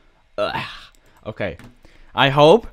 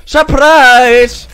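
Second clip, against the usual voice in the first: gleeful shout; 10 semitones higher, 13 dB louder than usual. Real, not acted. Ambushing an enemy in game.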